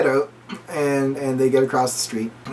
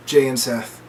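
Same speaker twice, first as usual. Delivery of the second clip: ordinary talk, nothing marked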